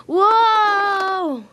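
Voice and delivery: high, loud whoa as he drops; falling yell. Acted. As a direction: cry out while falling. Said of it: "he yelled".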